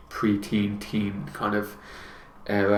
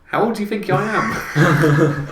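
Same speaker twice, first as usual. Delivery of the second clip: slow, high, laughing question; 9 semitones higher, slower than usual. Real, not acted. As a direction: laugh it off in disbelief. Teased about age, laughs.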